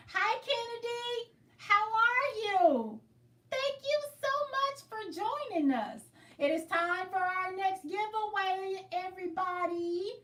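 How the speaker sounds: high, slow sing-song livestream host; host greeting voice. Acted. Welcoming a viewer and announcing a giveaway, high and slow.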